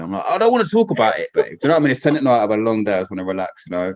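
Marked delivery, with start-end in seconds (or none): 0.3-1.4 high, loud re-enacted protest; exasperated refusal. Husband refusing wife late at night, acted exasperation. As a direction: plead to be left alone, pitch jumping up. Retelling his second, stronger refusal.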